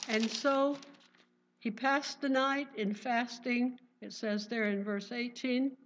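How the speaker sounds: usual speaking voice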